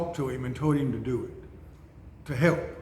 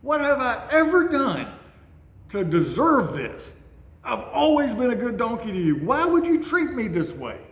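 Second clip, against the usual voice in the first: much higher, hurt and pleading; pleading talking donkey; 6 semitones higher than usual. Retold or imitated. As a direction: voice the wronged donkey, high and begging. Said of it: "and the donkey says".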